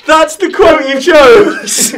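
loud, high, slow incredulous laughter; laughing disbelief. Real, not acted. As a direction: cry out through helpless laughter. Incredulous laughter at the chosen quote.

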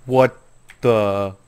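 loud startled outburst; surprised. Real, not acted. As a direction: caught off guard by the shark. Surprised by flying shark.

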